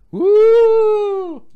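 high spooky ghost wail; ghostly woo. Acted. Ghost cry in play.

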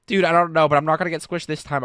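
normal talking voice

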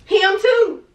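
loud, high-pitched retort; heated comeback. Real, not acted. Girlfriend answering about her own screensaver, heated comeback. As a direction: fire it back loud and high. Snaps back in wallpaper quarrel.

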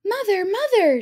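put-on high, loud boyish call. A narrator's reading. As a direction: call out to mother. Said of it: "Jack called".

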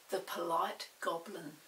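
ordinary delivery, nothing marked

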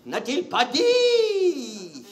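milk each word like a tragic actor; slow wailing recital. Shown as a demonstration. Comedian hams up the tragic line, wailing slowly. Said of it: "qu'est-ce qu'il n'a pas dit".

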